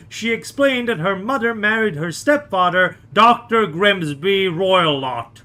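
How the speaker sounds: loud, urgent storytelling; dramatic story narration. Acted. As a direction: read it like a gripping mystery recap. Dramatic reading of case summary.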